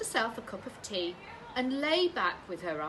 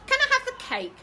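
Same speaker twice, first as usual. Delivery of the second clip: put-on very high, loud boy; boy asking for cake, high; high child voice; 11 dB louder than usual. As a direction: ask like a greedy little boy. Boy asks mum.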